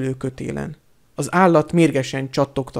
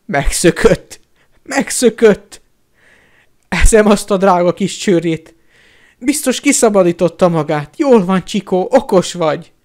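loud, high-pitched joyful bawling; Hagrid/character; 4 semitones higher, 7 dB louder than usual. A narrator's reading. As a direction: bellow with overjoyed relief. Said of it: "felhangzott Hagrid óbégatása". (hangos, örömteli óbégatás)

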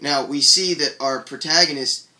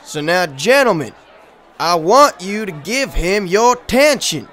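loud, raised announcer call; master of ceremonies; 5 semitones higher, 10 dB louder than usual. Acted. Host introducing a speaker to gentlemen, loud announcement. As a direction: call the room to attention.

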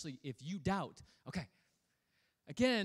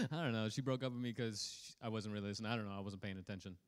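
movie line quote; 6 semitones lower, 7 dB quieter, faster than usual. Retold or imitated. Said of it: "my favorite line in Dumb and Dumber".

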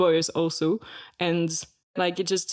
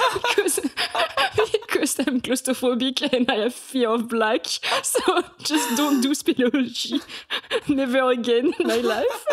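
laughing; 7 semitones higher than usual. Real, not acted. Laughing at her claustrophobia.

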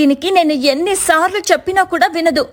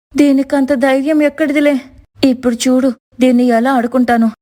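usual speaking voice

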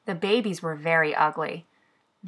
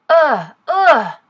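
loud, high double "ugh"; disgusted ughs; 8 semitones higher, 9 dB louder than usual. A narrator's reading. Little tiger sees banana-covered baby, loud disgusted ughs. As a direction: groan "ugh" twice in revulsion.

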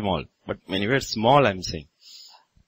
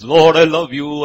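louder, higher gush of fake devotion; mock pious fraud; 4 semitones higher, 9 dB louder than usual. Preacher mimicking a fraud who claims to love God, hammed-up. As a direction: ham up a fake believer's praise. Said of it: "You are a fraud who says".

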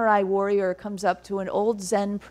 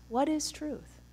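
"What is truth?" said much softer, inward, the word "is" leaned on; hushed reflective question; 6 semitones higher, 10 dB quieter than usual. Shown as a demonstration. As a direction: let the question hang, quietly. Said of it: "open up that question".

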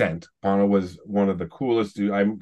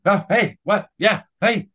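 high, louder bursts of confused hellos; startled greeting, imitated; 11 semitones higher, 4 dB louder, slower than usual. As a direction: act out someone caught off guard. Acting startled celebrity being hailed.

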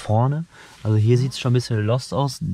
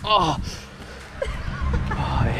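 startled gasp; 10 semitones higher, slower than usual. Real, not acted. Startled by a dog bark.

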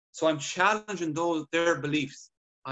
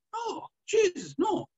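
high, slow amazed "no, Jesus"; patient amazed his hip no longer hurts; amazed disbelief; slower than usual. Retold or imitated. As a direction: stretch it out in astonished disbelief. Patient amazed pain is gone.

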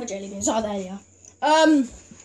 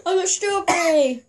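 higher, laughing through words; 11 semitones higher than usual. Real, not acted. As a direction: say it while laughing. Laughing reaction.

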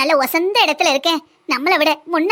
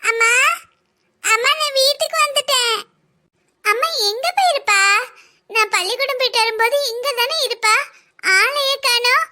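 put-on high, sing-song child voice; small child's higher-pitched voice; child looking for mother; 7 semitones higher than usual. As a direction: call out for mum like a little kid. Child character calls for mother.

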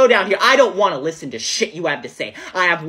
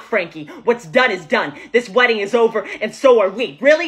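ordinary delivery

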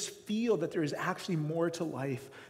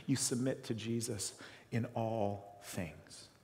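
softer and slow; solemn appeal; 5 semitones lower, 8 dB quieter, slower than usual. Real, not acted. Pastor urging the congregation, slow and solemn. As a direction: lay it down gently as the key point.

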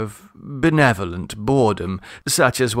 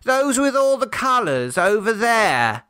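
put-on much higher small child pointing; child's voice; 10 semitones higher than usual. A narrator's reading. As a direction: voice a little child asking for flowers. Children answering the father.

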